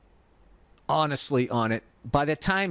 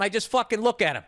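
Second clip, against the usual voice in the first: normal talking voice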